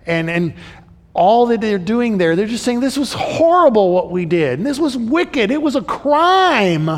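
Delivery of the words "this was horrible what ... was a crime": brothers confessing. Acted. Brothers voiced confessing to Joseph.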